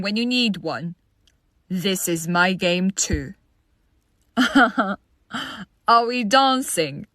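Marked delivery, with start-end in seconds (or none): 4.3-4.9 quick chuckle; a brief laugh between thoughts; short knowing laugh. Acted. Player claiming the game as their own, short laugh.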